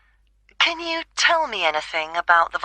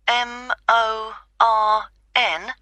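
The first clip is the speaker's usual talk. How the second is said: slow spelling; slower than usual. Real, not acted. Spelling out a surname.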